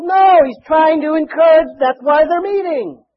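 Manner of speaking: loud, high-pitched preaching shout; shouted, then stretched out for emphasis; emphatic rebuttal. Real, not acted. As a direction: knock down the objection at full voice. Preacher refutes imagined objection.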